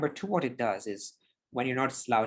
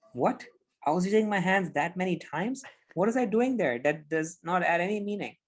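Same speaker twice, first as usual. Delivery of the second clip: higher, incredulous at yourself; mock disbelief; 6 semitones higher than usual. Speaker rewatching their own recording, disbelieving. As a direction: gasp at your own replay. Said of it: "you're like".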